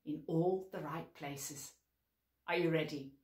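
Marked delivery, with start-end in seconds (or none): none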